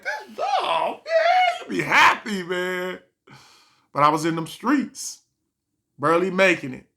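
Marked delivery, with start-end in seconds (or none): 0.0-3.0 high laughing shout; overjoyed. Retold or imitated. Getting seventy instead of forty, a high laughing whoop. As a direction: whoop with delight. Surprised by extra money.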